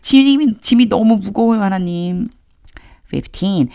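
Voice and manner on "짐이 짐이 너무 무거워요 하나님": much higher and louder, a pleading lament; Moses' complaint. Acted. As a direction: beg God like a worn-out leader. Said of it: "모세가 계속해서 하나님께 하소연하고".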